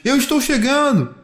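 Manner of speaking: high answering holler; child's high-pitched call; calling back. A narrator's reading. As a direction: call out that you are arriving. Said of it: "Adrien diz".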